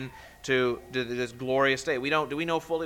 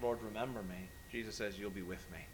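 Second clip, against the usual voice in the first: 1 quiet; 3 semitones lower, 12 dB quieter than usual. Acted. Softly contrasts request and answer.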